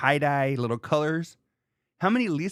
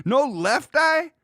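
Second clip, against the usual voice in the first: excited; 13 semitones higher, 6 dB louder, slower than usual. Real, not acted. Excited recall of a name.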